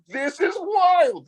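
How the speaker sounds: very high, slow shout of disbelief; amazed exclamation. Retold or imitated. Telling the girl how amazed he was, slow high shout. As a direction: let it burst out high and drawn-out, stunned. Amazed at Dutch man's reaction.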